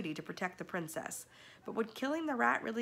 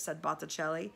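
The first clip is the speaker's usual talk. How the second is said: plain reading voice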